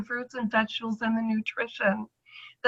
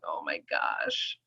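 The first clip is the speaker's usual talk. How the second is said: low, slow dreading gasp; 9 dB quieter, slower than usual. Retold or imitated. To herself facing the doctor, low slow dread. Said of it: "I'm going".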